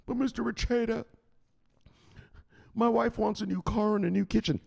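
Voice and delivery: high-pitched, whiny-pupil objection; pleading follower. Acted. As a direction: play the follower making excuses. Voices a complaining follower, then rebukes him.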